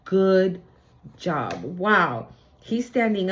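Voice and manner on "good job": deep low voice on job, spoken slowly; a deep, grave archangel praising; archangel's praise. Retold or imitated. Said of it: "I'm hearing".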